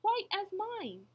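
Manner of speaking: high-pitched earnest call; calling back. A narrator's reading. Horton answering the mayor, calling back. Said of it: "And Horton called back".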